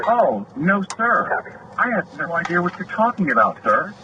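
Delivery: imitating a smirking, sarcastic "sir"; smug mock politeness. Mimicking the officer who rolled his eyes, smug fake politeness. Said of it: "rolled his eyes and smiled and goes".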